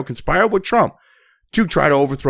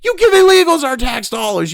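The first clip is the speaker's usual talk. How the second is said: angry retort; 10 semitones higher, 4 dB louder than usual. Real, not acted. Ranting at leftists.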